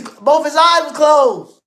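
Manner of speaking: high, loud and slow, stunned; amazed shout. Real, not acted. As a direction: shout it out like you can't believe it. Amazed about knockout fight.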